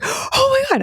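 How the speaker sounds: very high, shrieked Oh my God; sister's horror, squealed; shocked shriek. Retold or imitated.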